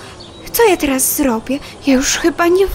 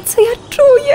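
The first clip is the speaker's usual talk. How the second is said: a fly choking up over her feelings; tearful; 10 semitones higher, 3 dB louder than usual. Acted. Fly tells rat what she feels, on the edge of tears. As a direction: voice cracking, pitched up, close to tears.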